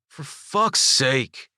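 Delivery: slow, drawn-out frustrated cry; exasperated outburst. A narrator's reading. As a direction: drag it out in disgust.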